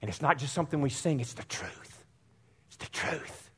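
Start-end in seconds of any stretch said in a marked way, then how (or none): 1.2-2.1 unvoiced, much softer; whispered emphasis. Real, not acted. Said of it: "hushed emphasis in sermon". 2.7-3.5 unvoiced, much softer; whispered emphasis. Real, not acted. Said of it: "hushed emphasis in sermon".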